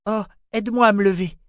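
put-on urgent girlish begging; pleading. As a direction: beg your mother for help. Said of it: "dit-elle à sa mère d'un ton suppliant".

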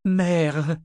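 high, plaintive cry; moaning appeal; moaned. A narrator's reading. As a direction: whine for mother. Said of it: "Reine gémit".

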